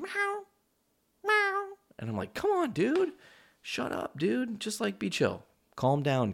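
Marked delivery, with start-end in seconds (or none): 0.0-1.7 very high imitation cat meows; obnoxious meowing. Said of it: "start meowing really loudly and obnoxiously". 2.3-6.3 high-pitched mock exasperation; pleading with cat. Acted pleading with the cats.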